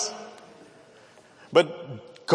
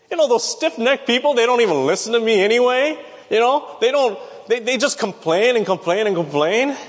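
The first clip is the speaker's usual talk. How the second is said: mock complaining; 7 semitones higher than usual. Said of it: "That's the way I would have responded".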